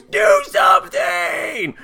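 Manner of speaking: high, drawn-out strained scream; mock scream. Brad screaming for help over the body, mock scream. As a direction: scream it slowly, hammed-up. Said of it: "And then Brad screams".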